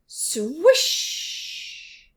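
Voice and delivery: high unvoiced swoosh; breathy swish. A narrator's reading. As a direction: whoosh like the swing. Swing sound effect.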